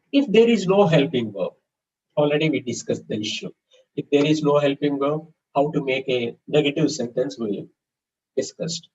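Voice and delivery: usual speaking voice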